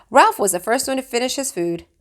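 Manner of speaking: ordinary delivery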